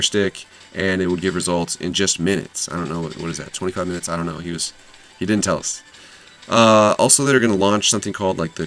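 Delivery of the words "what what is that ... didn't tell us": usual speaking voice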